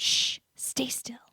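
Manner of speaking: whispered warning; urgent whisper. A narrator's reading. Jimenez hushing the group in the dark, urgent whisper. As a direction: hiss it, hushed and urgent. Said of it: "Jimenez said".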